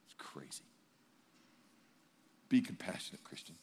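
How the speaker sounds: very soft, near-whispered aside; hushed plea. Real, not acted. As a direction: drop to a hush to plead.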